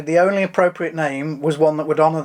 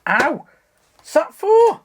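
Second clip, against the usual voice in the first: sudden high-pitched ouch; yelp, surprised by the hit; pained yelp; 13 semitones higher, 4 dB louder, slower than usual. Real, not acted. Hit by friend.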